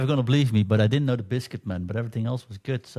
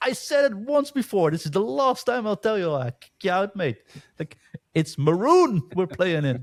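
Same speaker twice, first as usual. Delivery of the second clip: high, mock stern teammate telling him off; high-pitched hammed-up scolding; stern warning; 9 semitones higher than usual. Teammate scolding him for saying purple, high mock stern. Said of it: "And he turned around".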